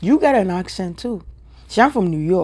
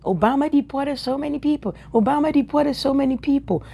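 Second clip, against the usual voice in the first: higher-pitched mimicked griping; mock complaint; 6 semitones higher than usual. Speaker mimicking people who yapped about Obama deporting, mock complaint. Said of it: "We were yapping".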